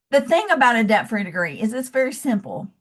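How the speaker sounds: ordinary talk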